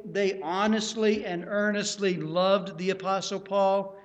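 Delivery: ordinary talk, nothing marked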